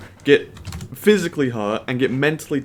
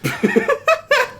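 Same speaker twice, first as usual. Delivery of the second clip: high laugh; 4 dB louder than usual. Real, not acted. Laughing at pal.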